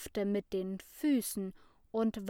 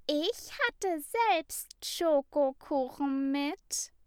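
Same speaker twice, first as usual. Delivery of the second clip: high, louder sing-song voice; fluting; 7 semitones higher, 4 dB louder than usual. A narrator's reading. Johanna calls from the back corner, high and fluting. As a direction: trill it sweetly. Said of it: "flötete Johanna".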